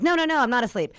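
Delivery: loud, high, insistent refusal to fall asleep; jolting out of a poppy-field doze, loud and high; mock defiant shout. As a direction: shout yourself awake, half playful. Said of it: "You're like".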